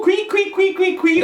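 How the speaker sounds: high chirping bird sound. Acted. Teacher imitates little birds while describing ad colours, high and chirpy. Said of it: "les petits oiseaux".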